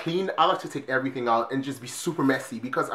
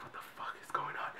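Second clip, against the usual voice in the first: unvoiced hushed whisper; 19 dB quieter than usual. Hiding as police swarm the hall, whispering. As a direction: whisper so the cops can't hear.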